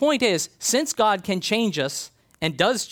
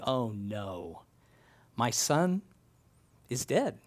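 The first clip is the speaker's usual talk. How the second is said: softer, lower, broken-hearted lament; grieving father; 4 semitones lower, 6 dB quieter, slower than usual. Retold or imitated. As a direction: Jacob mourning his son over the bloody tunic. Jacob grieves over tunic.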